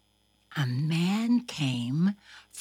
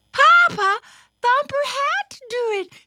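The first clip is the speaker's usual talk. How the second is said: very high, loud squeak; tiny bunny voice protesting loudly; little sister pleading; 8 dB louder than usual. A narrator's reading. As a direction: plead with papa for your brother. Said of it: "said one of the sisters".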